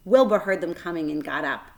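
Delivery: ordinary delivery, nothing marked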